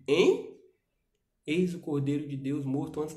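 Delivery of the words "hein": mock incredulous.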